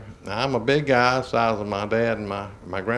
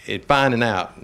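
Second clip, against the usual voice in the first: usual speaking voice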